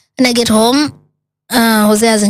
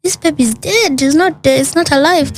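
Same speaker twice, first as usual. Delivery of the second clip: high, matter-of-fact doctor voice; blunt doctor; 7 semitones higher than usual. Retold or imitated. Doctor telling patient the pregnancy failed, high blunt voice. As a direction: state it flatly, pitched high. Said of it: "And she's like".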